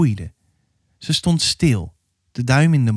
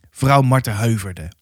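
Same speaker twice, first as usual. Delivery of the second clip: plain reading voice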